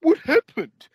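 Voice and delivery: high, loud mock panic, jumping up on the question; panicked voter. Mocking voters who panic when it goes bad, high shrill mock panic. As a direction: squeal it like a voter caught out. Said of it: "they're gonna go".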